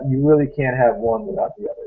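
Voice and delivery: ordinary talk, nothing marked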